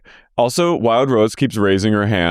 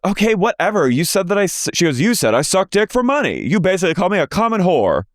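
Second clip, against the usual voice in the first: much higher, indignant put-on voice; high parody of an angry retort; imitating Bronwyn; 9 semitones higher than usual. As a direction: fire back, offended. Mimicking Bronwyn.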